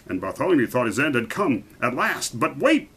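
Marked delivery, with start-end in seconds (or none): 2.4-2.9 a higher, loud royal call; the king shouts to halt the Grand Duke; shouted. A narrator's reading. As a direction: stop them with a shout. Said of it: "shouted the king".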